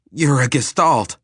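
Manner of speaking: high, loud burst of realization; excited realization. Acted. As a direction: blurt it out as it clicks. Eric's sudden realization.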